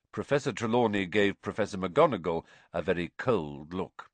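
ordinary delivery

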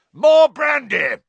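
very high, loud, slow shout; panicked uncle's shout; yelling Uncle Vernon. A narrator's reading. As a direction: bellow to change the subject. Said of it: "yelled Uncle Vernon".

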